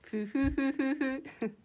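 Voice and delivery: slow, drawn-out creepy chuckle; a slow, eerie giggle. Real, not acted. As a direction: chuckle slowly like someone scheming. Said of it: "文字に起こすとめっちゃ怖いね".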